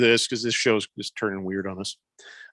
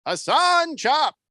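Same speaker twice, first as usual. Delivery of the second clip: high drawn-out cartoon call; 13 semitones higher, much slower than usual. Pun sung like cartoon.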